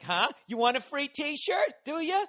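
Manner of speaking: high, slow, goofy teasing; high cartoonish hype-man voice; mock hype man. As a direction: imitate a T-shirt cannon guy taunting the crowd. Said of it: "pointing up at the upper deck like".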